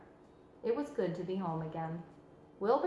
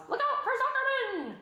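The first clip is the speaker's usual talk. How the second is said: high gander call; 3 dB louder than usual. A narrator's reading. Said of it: "called the gander".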